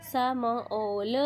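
"स म ऑल" in slow, separate sounds; phonics blending. Shown as a demonstration. Teacher leading pupil to read with phonics, sounded out. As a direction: sound out "स", "म", then "ऑल". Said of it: "पढ़ना है फोनिक्स के साथ".

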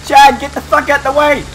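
high, urgent angry orders; exasperated yelling. Real, not acted. Player ordering Chad out of the way, exasperated yelling. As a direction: yell frantic orders at a useless teammate.

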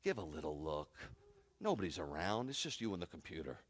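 low, soft, coaxing tempter; hushed voice of temptation; tempting enemy. Acted. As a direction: coax them quietly, pitched well down. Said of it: "that's how the enemy talks".

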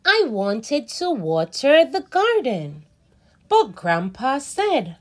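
put-on slow, expressive read-aloud for kids; reading to children. A narrator's reading. Teacher reading a story to children, animated and slow. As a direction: read it like a picture book for little ones. Reads picture book aloud.

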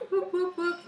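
three high-pitched cheering whoops; playful whooping. Pretend. Cheering Henrietta at the helm, playful whooping. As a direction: whoop it up in short high bursts.

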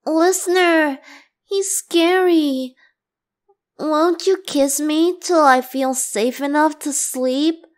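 high, pitiful fake fright; mock scared plea. Venti fakes fear to win the listener against Xiao, mock pleading. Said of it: "you can't be falling for that".

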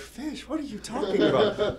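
high, laughing confused reply; incredulous villain voice; baffled retort. Retold or imitated. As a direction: act puzzled by the fish threat. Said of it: "he's like".

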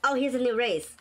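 normal talking voice

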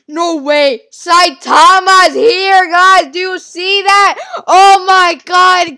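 loud, high, drawn-out excited shouting; ecstatic disbelief. Real, not acted. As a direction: shout in amazed excitement, stretching the words. Gamer thinks Saitama spawned in.

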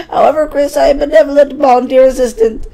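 high, loud proclamation; grand benevolent librarian. Acted. Library assistant to a late borrower, high pompous announcement.

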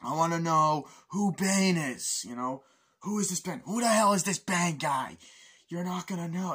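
hammed-up agent shouting; mock interrogation. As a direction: grill the prisoner about Bane. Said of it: "like that".

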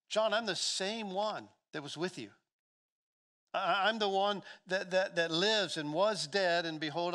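urgent, full-voiced declaration to a friend; impassioned preaching. Preacher voices Jesus addressing John.

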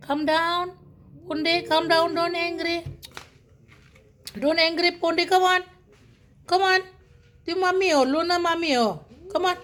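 high-pitched pleading with a pet; urging calm. Real, not acted. Urging angry dog to calm down. (mataas na boses na umaawat sa aso)

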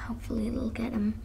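breathy hushed aside; whisper. Pretend. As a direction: whisper it under your breath. Aside hoping quietly.

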